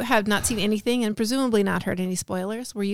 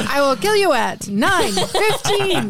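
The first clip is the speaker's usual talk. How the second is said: high, loud, slow robotic threat; robotic killer voice; 7 semitones higher, 4 dB louder, slower than usual. Pretend. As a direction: read it like an automated killer hotline. Automated killer phone bit.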